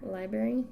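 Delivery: quiet library without its second R; library with the R swallowed; R dropped in library. Real, not acted. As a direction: say library softly as "LIE-berry". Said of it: "knock out that second R".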